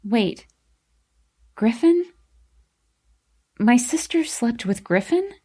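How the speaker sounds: rising, incredulous questions; confused. A narrator's reading. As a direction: puzzle it out, pitch leaping up.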